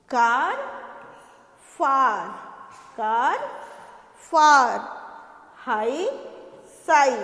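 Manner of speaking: rhyming pairs called out loud to the class; rhyme drill. Shown as a demonstration. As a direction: chant each rhyme pair for children. Reading rhyming pairs aloud. (कक्षा को तुकबंदी ज़ोर से बोलना)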